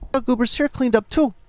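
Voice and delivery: high-pitched put-on Bronx voice; cartoonish high Bronx accent. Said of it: "that's my Bronx accent".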